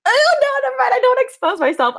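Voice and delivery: high, loud, rushed panic; flustered, heart racing. Real, not acted. Girl stopping herself mid-confession, flustered squeal. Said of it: "my heart's racing".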